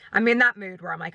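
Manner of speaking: ordinary talk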